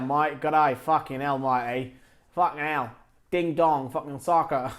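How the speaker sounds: hammed-up Aussie impression; mock Aussie, badly faked; poor Australian accent. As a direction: put on a bad, jokey Australian accent. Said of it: "that was my poor impression of Australia".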